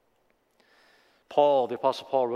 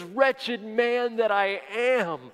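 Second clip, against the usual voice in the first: slow lament; 7 semitones higher, much slower than usual. Acted. Paul's lament.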